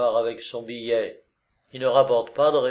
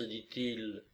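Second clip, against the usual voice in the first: ordinary delivery, nothing marked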